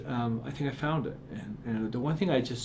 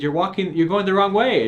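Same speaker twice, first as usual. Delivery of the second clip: higher, louder teasing call; joking; 7 semitones higher, 9 dB louder than usual. Retold or imitated. Author kidding a boy leaving the store, joking. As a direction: call it out as a joke. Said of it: "I just kind of joking".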